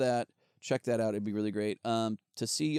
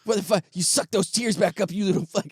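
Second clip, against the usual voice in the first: louder, higher hammed-up yelling at the TV; mock furious rant; 4 semitones higher, 3 dB louder than usual. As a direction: tear into the crying man on screen. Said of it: "I used to just be like".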